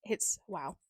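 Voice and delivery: soft unvoiced whisper. Real, not acted. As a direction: breathe it out. Muttered aside.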